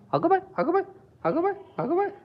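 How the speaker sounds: high, soft sing-song coo. Real, not acted. Fussing softly over the kitten, high quiet coo. Cooing at the cat.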